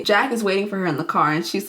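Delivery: normal talking voice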